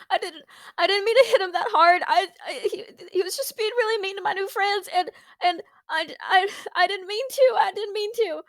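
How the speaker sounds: high, stammering tearful plea. Acted. Cecily pleading with the headmaster, high teary stammer. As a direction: choke out the apology close to tears. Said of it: "She's got tears in her eyes. She's like".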